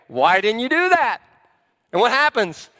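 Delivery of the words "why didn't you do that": louder, higher exasperated demand; exasperated parent. Acted. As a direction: scold like a fed-up parent. Parent confronting kid over chores.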